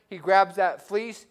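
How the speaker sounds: normal talking voice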